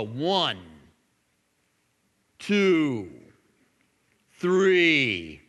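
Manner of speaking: drawn-out count with long pauses between numbers; slow mocking count. Mimicking the atheist daring God, slow count. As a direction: count it out slowly, taunting the heavens. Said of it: "count from one to sixty, really slow".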